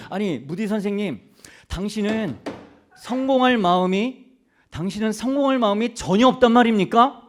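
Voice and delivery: pressing demand. Retold or imitated. Reporters pressing Moody about his ambition, loud incredulous demand. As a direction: push him hard, like you can't believe it.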